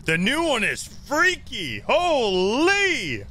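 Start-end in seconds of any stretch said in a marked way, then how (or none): 1.8-3.2 sudden loud outburst. Real, not acted. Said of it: "[name], you scared me".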